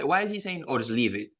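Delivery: normal talking voice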